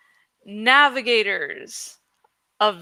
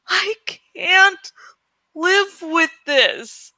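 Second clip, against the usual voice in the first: higher, tearful moan, slowing at the end; 8 semitones higher, 3 dB louder, much slower than usual. Acted. Eragon waking in grief, crying moan. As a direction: let the grief break through the words. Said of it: "he moaned".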